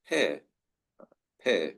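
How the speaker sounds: "pear" as "peh", no R; British pronunciation. Shown as a demonstration. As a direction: drop the R on "pear", falling tone. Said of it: "in British pronunciation".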